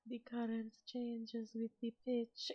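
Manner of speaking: slow, drawn-out siren character voice; Aurelia. Shown as a demonstration. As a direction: stretch the words out, slightly lifted. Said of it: "Aurelia's voice".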